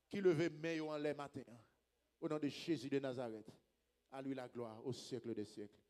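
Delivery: low, subdued praying voice; solemn pastoral prayer; earnest prayer. Real, not acted. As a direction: pray it through to a low close. Prays for the congregation.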